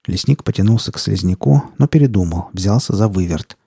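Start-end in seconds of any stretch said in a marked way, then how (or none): none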